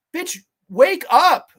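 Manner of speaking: slow, loud call to wake up; a slow, loud wake-up jab; loud scolding. Real, not acted. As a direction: yell it at a dozing actress. Scolding sleepwalking actress.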